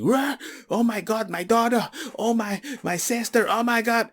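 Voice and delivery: high, frantic mock panic; mimicking the family's panic in the hospital. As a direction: imitate panicking relatives, voice up high. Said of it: "Everybody was panicking".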